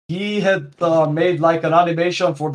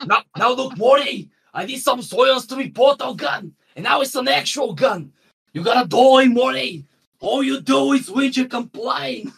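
high Aussie cartoon grandpa, shouting at Morty; broad Australian Rick, much higher; Australian Rick accent; 9 semitones higher than usual. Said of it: "That was a pretty good accent".

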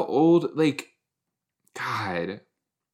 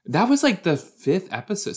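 excited high exclaim; 8 semitones higher than usual. Real, not acted. Excited realisation while watching.

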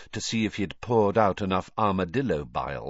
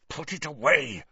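snarling; 6 semitones higher than usual. Acted. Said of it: "snarled Snape".

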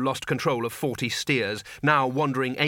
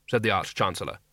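plain reading voice

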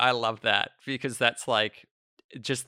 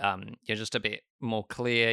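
ordinary talk

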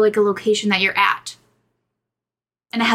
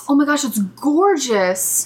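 awed; 2 semitones higher, slower than usual. Real, not acted. Awed at lake picture.

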